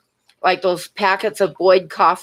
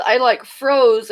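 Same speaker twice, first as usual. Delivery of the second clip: ordinary talk, nothing marked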